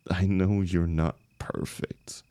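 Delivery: ordinary talk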